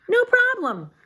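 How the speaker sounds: friendly bird voice; cheerful birds. Acted. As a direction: chirp it breezily.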